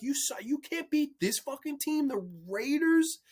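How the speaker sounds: high, outraged fan's disbelief; angry Denver fan. Retold or imitated. Imagined Denver fan ranting at his team, high outraged disbelief. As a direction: vent as a betrayed fan would. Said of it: "I'm like".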